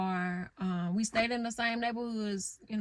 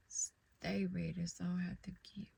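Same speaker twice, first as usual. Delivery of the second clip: whispering to herself, slowed; a near-whisper aside to God; hushed aside; 2 semitones lower, 7 dB quieter than usual. Real, not acted. Alone, musing to herself in a hushed whisper. Quiet self-talk to God.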